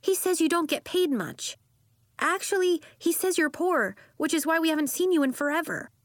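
high-voiced girl blabbing on; high, tactless little-kid tattle; blurting child. A narrator's reading. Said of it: "she blurted".

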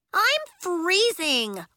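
high, loud, drawn-out whine; slow, high-pitched complaint; wailing. A narrator's reading. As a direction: wail miserably like a soaked kid. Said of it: "wailed a girl with sodden curls".

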